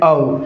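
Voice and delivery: "आओ" as "ov", ending in a V sound. Shown as a demonstration. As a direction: say "आओ" for "of", with V instead of F. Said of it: "क्या बोलते हैं".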